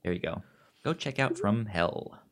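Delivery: ordinary delivery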